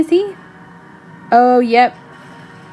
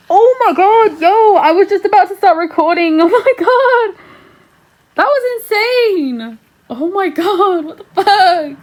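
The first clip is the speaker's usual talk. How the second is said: stunned outburst; 7 semitones higher, 3 dB louder than usual. Real, not acted. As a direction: cry out in real disbelief. Shocked by an insane scene.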